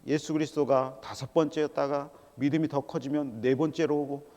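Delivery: usual speaking voice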